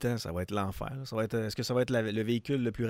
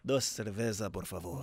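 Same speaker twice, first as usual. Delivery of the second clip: lower, softer deep voice; 7 semitones lower, 7 dB quieter than usual. Retold or imitated. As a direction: drop the voice low and soft. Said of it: "on a comme une voix plus grave".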